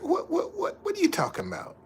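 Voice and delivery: squeaky high, fast, baffled imitation; imitating confused listener. Streamer mocking a doubter's reaction, high squeaky disbelief. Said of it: "you're like".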